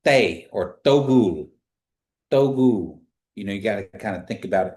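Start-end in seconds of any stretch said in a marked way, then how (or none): 0.0-0.5 "they" said loud with tongue against teeth; stopped "they", tongue and teeth together; "they" pronunciation demo. Shown as a demonstration. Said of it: "to make that". 0.8-3.0 "togul" said loud with a stopped T, tongue on teeth; emphatic "togul" with the T stop; slow exaggerated speech modeling. Shown as a demonstration. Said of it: "to make that".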